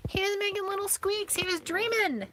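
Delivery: very high-pitched pet-cooing squeal. Real, not acted. Cooing over a dreaming pet.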